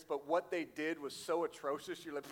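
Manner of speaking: ordinary delivery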